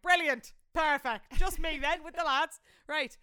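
loud, emphatic mock enthusiasm; sarcastic delight. Only girl left with the lads, sarcastically delighted. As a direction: punch out each word with exasperated irony. Learns the other girls went to bed.

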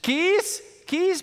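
put-on high little girl asking, puzzled; toddler voice. Father voicing his toddler asking for keys. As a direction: squeak it like a small child.

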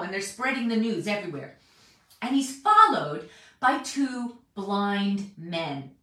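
ordinary delivery

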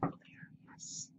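whispered yes; a quick hushed yes so the dogs stay calm. Real, not acted. Checking dogs didn't hear.

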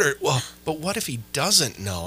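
ordinary talk, nothing marked

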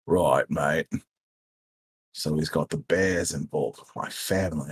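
an Australian mobster piecing it together; low gruff character voice. Acted.

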